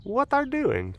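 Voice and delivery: a lightly voiced bewildered pet; pet's inner voice. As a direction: voice the dog's confusion lightly. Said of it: "He's like".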